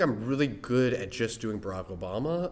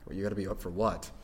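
ordinary talk

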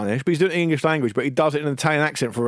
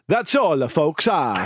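exaggerated mock-Italian sign-off; bizarre Italian accent; much slower than usual. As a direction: do the fake Italian accent with more gusto. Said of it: "Do you want to do that better?".

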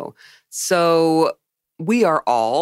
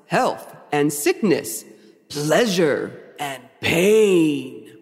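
grand, emphatic devil's speech slowing to solemn pairs; hammy movie villain oration, building in deliberateness; Michael Baum Lucifer. Acted. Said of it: "That's how he says it".

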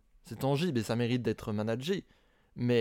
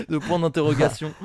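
laughing; 4 semitones higher, 5 dB louder than usual. Real, not acted. Laughing through line.

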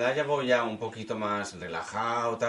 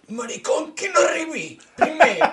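put-on high, louder old-timer chanting a schoolyard rhyme; mock old man; 8 dB louder than usual. Playing the old man, he recalls a childhood rhyme. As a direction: cackle it like a forgetful grandpa.

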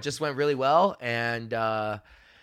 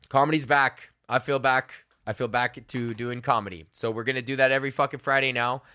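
ordinary talk, nothing marked

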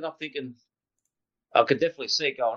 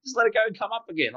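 higher, mocking sing-song, getting faster; teasing mockery; 6 semitones higher, faster than usual. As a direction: rib him like the boys on the radio. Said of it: "the boys are still taking the piss".

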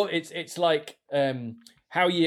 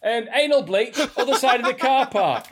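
higher and louder through laughter; laughing; 10 semitones higher, 5 dB louder than usual. Pretend. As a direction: laugh while playing the clerk. Comic shop dialogue, laughing.

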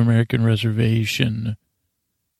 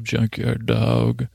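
drawn-out nickname; slower than usual. Retold or imitated. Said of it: "that's what I call him".

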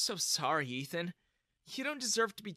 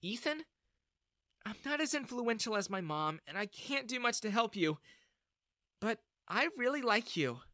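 shy confession; 7 semitones higher than usual. A narrator's reading.

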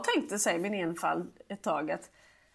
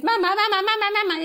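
higher, loud insistent calling; a small boy shouting for his mother; child calling mum; 9 semitones higher, 7 dB louder, faster than usual. Retold or imitated. Son shouting at mum playing dead, loud calls.

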